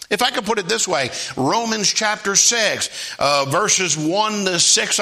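high-pitched preaching with stretched key words; emphatic sermon delivery; fervent preaching. Real, not acted. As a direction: drive it home like a preacher.

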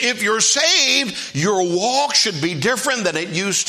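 high-pitched, emphatic sermon voice; preacher urging the congregation on; fervent preaching. Real, not acted. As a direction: preach it with drawn-out key words.

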